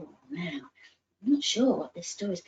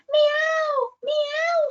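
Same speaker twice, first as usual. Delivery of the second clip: high, louder urgent meows; crying meows; 7 dB louder than usual. Acted. Rosie calling George over to the rocks, urgent high meows. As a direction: call out like a cat that found something. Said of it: "she cried".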